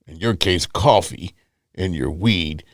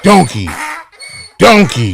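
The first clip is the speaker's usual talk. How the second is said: donkey sound effect; 7 semitones higher, 3 dB louder than usual. Acted. Mock sound effect for caller.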